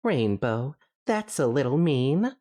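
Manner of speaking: a hint of timid pony chiding. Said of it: "said Fluttershy".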